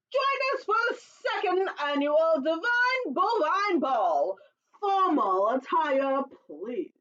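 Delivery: high, loud grand announcement voice; posh invitation. Acted. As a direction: read it like a fancy ballroom herald. Cows' formal invitation read out.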